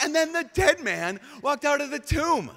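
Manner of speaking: high, loud, thrilled reading; exclamation mark. Shown as a demonstration. Preacher rereading the verse with full excitement, high and loud. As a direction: read it as the miracle it is. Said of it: "underline, exclamation mark".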